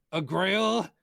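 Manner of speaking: "A grail!" shouted high and loud; grand exclamation. Shown as a demonstration. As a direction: proclaim "A grail!" with awe. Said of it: "sorry, I didn't read that right".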